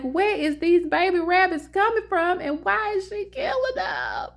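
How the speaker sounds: very high, frantic outcry; panicked shriek. Retold or imitated. Owner shrieking as her dog catches another rabbit, frantic. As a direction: shriek it in disbelief.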